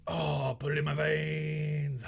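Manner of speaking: slow, savoring, blissed-out voice; blissful craving. Retold or imitated. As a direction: savor it like a hit. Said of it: "you're like".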